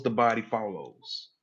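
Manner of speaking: ordinary talk, nothing marked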